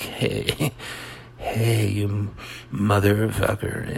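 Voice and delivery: slow, easygoing cursing with a chuckle; mock mellow. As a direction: curse someone out as if totally chilled. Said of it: "melodramatic is like".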